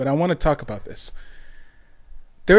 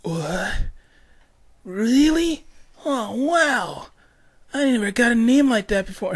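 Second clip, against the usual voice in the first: much higher, wide-eyed sixth grader; amazed kid; 9 semitones higher than usual. Retold or imitated. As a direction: squeal it out like a thrilled kid hearing news. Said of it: "And I was like".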